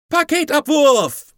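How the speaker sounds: high, drawn-out battle cry; loud, high, stretched call; joyful shout. A narrator's reading. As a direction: yell it out long and high like a kid bailing out. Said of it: "rief der Grüne".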